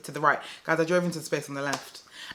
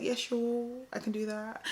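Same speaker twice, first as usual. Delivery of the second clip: soft, higher, unhurried agreement; retold calm; 7 semitones higher, 10 dB quieter than usual. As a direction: agree lightly and serenely, imitating composure. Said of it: "I was calm".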